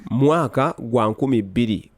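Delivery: usual speaking voice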